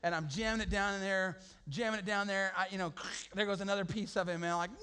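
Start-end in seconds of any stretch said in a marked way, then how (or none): none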